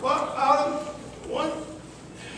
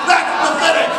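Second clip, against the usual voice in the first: plain reading voice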